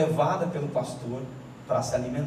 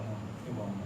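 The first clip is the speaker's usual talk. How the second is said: lower, soft and firm loving voice; God's voice; 7 semitones lower, 9 dB quieter than usual. Retold or imitated. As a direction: speak as a loving father correcting. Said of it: "Ele fala".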